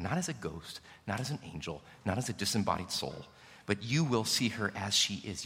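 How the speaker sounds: put-on gently reassuring a grieving young woman; gentle consoling. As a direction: comfort her quietly, like a pastor to a grieving girl.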